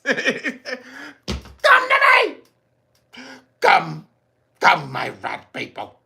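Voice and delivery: very slow, high summoning call; calling rats. Retold or imitated. Streamer mocks the mayor calling rats, slow and laughing. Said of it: "calling rats".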